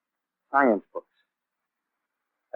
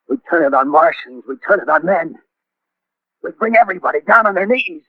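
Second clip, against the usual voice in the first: building from eager to triumphant, higher with each line; rising fervor; 6 semitones higher than usual. Acted. Artilleryman dreams aloud to the stranger of conquest, building fervor. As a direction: let the dream of power swell up.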